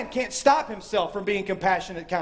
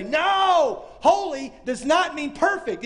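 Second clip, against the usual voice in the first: No shouted high, then slowed and firm; emphatic correction; 7 semitones higher, slower than usual. Acted. Friend correcting the discourager, shouted no then slow and firm. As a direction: cut the lie off hard, then set it straight.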